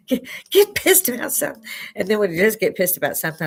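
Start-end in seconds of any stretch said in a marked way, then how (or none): 0.1-1.6 high, loud mock nagging of a too-calm husband; mock exasperated urging. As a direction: push him to get angry, hammed-up. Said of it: "It's like".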